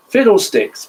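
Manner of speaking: high, loud boom. A narrator's reading. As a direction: boom it out noisily. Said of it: "boomed Mister Noisy in a boomy, noisy voice".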